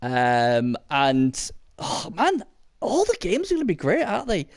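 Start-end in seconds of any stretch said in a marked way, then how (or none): 1.8-4.5 high, fast and giddy; bubbling over with excitement; excited. Real, not acted. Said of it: "I'm so excited".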